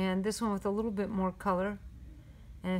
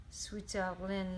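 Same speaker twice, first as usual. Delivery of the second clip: "Switzerland" slowly, French-style, as "switelin"; a light French take on "Switzerland"; French accent; 3 semitones lower, 8 dB quieter, slower than usual. Acted. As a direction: drop the English z and end nasally in a French accent. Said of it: "in my best French accent".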